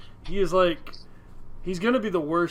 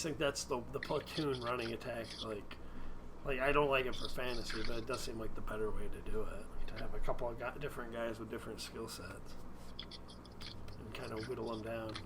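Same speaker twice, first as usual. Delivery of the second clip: hushed, half-voiced whisper; 4 semitones lower, 10 dB quieter than usual. Real, not acted. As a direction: keep it low, as if someone might overhear. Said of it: "Why are you whispering?".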